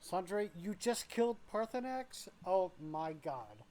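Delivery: high, loud, incredulous outcry; shocked disbelief. Real, not acted. Streamer reacting to a viewer killing Paarthurnax, shocked. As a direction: gasp it out as if the news just hit.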